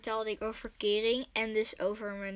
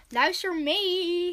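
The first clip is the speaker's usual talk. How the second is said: bright invitation; 7 semitones higher, 3 dB louder, much slower than usual. Real, not acted. Cheerful invitation to listen.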